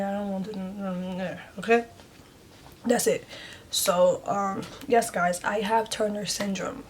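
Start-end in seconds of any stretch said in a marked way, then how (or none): 0.0-1.9 soft hums while swallowing sobs; holding back tears. Real, not acted. Vlogger refusing to cry in front of viewers, choked hums. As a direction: stop yourself from crying. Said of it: "I don't want to be crying".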